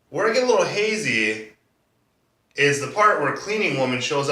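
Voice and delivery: animated, incredulous cross-examination; sarcastic prosecutor. Acted. D A doubts the defendant's coincidence, sarcastic and animated. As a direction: needle the witness with theatrical doubt.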